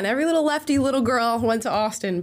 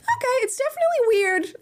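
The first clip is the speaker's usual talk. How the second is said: surprised. Retold or imitated. Imagined viewer reaction.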